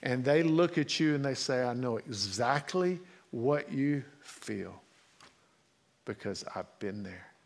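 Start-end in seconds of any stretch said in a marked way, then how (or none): 1.6-7.3 low, much softer, tender and knowing; quiet understanding. Retold or imitated. Friend consoling a hurting person, softly and with understanding. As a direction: hush it like a friend who has been there. Friend who shares your pain.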